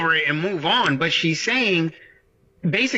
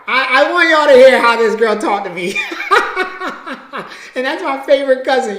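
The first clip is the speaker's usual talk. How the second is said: loud, high, cracking up; real laughing; 7 semitones higher, 9 dB louder, faster than usual. Real, not acted. Speaker amused at favorite cousin's phone rudeness, laughing. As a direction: burst out laughing through the words.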